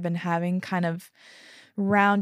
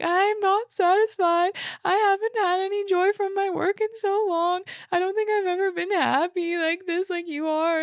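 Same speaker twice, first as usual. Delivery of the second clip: hammed-up tearful whine, pitched way up; mock bawling; 12 semitones higher than usual. Crying wife confesses to her partner she is unhappy, mock bawling. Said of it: "like, bawling".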